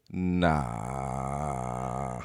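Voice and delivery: very low, soft, drawn-out refusal. Acted. Comic drops the nerd act, low drawn-out refusal. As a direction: stretch it out deep and quiet.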